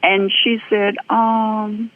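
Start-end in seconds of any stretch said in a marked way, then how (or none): 1.1-1.9 reluctant answer. Retold or imitated. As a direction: give it grudgingly, hold back. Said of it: "It's like pulling teeth".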